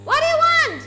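loud, high "What do you want?"; shout. Real, not acted. Gamer yells at the creepy stranger, loud and high.

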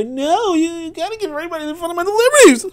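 high mock voice, fast; mocking naive idealist. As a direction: parody the people who want to hear this, pitched high and quick. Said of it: "I know you wanna hear".

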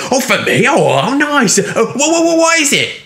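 very high, loud, stuttering excitement; excited stammer. A narrator's reading. Richard hears of his surprise, excited and stammering. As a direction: burst out, tripping over the words.

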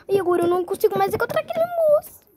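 ordinary delivery

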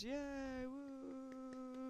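high squealing yay and woo; hammed-up high celebration; mock cheer. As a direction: cheer like a delighted kid. Celebrating troops gained.

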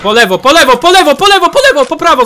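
loud, rising repeated directions; urgent callout. Real, not acted. Gamer warning teammates of enemy's side, urgent.

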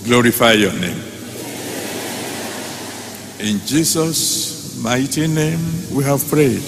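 ordinary talk, nothing marked

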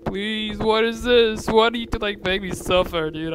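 high, loud whining plea; unhappy pleading. Real, not acted. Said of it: "I'm, like, not happy".

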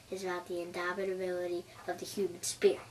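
normal talking voice

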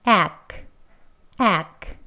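short clipped 액 sound, said twice; syllable ack. Shown as a demonstration. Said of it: "두 번째 음절".

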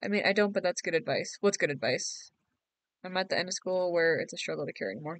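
usual speaking voice

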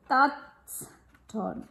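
ordinary delivery, nothing marked

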